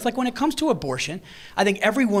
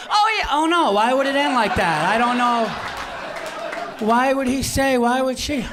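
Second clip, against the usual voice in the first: mock confused audience; 4 semitones higher, slower than usual. Mocking the audience's confused objections.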